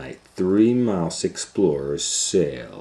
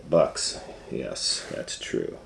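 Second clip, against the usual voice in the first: ordinary delivery, nothing marked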